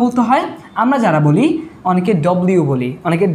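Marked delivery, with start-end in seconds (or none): 2.3-2.6 "ডব্লিউ" as dobliu, round first vowel; mispronounced W. Shown as a demonstration. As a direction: say "ডব্লিউ" with an o, squeezed bliu. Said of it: "এভাবে না". ("ডব্লিউ" ভুল, ডব-লিউ)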